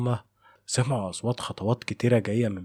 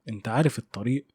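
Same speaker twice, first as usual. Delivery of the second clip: usual speaking voice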